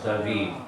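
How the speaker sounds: David with the final D almost gone; weak last D. Shown as a demonstration. Teacher answers how strong the D is, weak D on David. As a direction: say David as "da-VEE", softly trailing off the D. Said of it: "Not strong at all".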